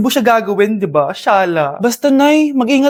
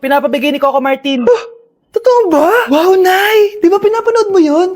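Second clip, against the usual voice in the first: usual speaking voice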